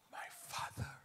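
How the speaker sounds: breathless unvoiced whisper; a hushed whispered cry to his father; awed whisper. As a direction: barely breathe the words out. Elijah's cry as he rises.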